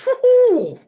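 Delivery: high, loud delighted ooh; excited squeal. Acted. Husband peeping at neighbours, high loud ooh. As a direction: squeal at what you see.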